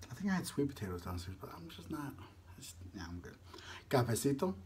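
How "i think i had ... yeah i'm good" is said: soft fading mumble; muttering to himself. Real, not acted. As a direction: trail off under your breath. Trailing off to himself.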